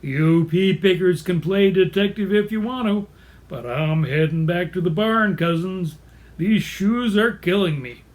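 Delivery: folksy [name] imitation; Hank the horse. Horse heading back to the barn, [name] voice. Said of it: "He sounds like [name]".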